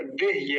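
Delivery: देह्य with ह run into य; half ह in देह्य. Shown as a demonstration. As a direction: clip no vowel onto the ह of देह्य. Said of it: "ह आधा है इसमें". (देह्य में आधा ह)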